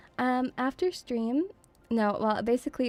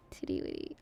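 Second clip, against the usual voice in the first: put-on baby talk mumble; 12 dB quieter than usual.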